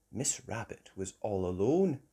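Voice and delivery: plain reading voice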